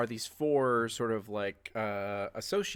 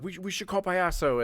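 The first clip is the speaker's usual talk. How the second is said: higher, anxious suggestion; worried suggestion; 7 semitones higher, 3 dB louder than usual. Acted. A partygoer urging them to call the fixer, anxious. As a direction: propose it nervously. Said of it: "they're like".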